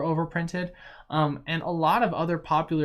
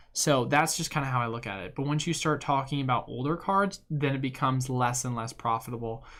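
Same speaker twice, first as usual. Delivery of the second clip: ordinary talk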